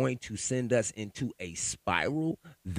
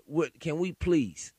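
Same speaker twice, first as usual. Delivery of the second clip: usual speaking voice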